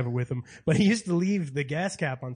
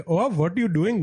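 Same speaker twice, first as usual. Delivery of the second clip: put-on lilting, higher-pitched Indian accent; 4 semitones higher than usual. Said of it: "most Indian guys are like".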